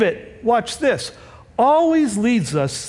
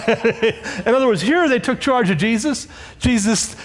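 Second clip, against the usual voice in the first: ordinary talk, nothing marked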